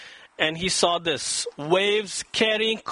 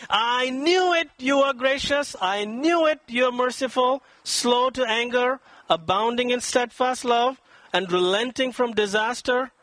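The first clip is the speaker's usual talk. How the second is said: higher, sarcastic grumble at God; grumbling complaint; 6 semitones higher than usual. Acted. Angry prophet grumbling at God, higher and scornful. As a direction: throw God's kindness back in his face. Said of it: "He's grumbling".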